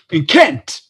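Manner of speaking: high, loud triumphant answer; spring it on them like a mad genius; Doc Brown impression. The Doc Brown actor guessing their county, loud high voice. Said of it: "And he went".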